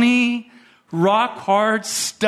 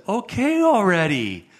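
higher-pitched, mock-exasperated boss; 5 semitones higher than usual. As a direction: imitate a boss complaining, drawing out the grievance. Boss's exasperated outburst, retold.

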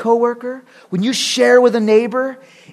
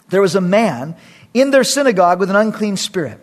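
ordinary talk

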